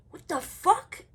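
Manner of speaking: high, loud startled cry; shocked outburst. Retold or imitated. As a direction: blurt it out in shock. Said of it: "And I'm like".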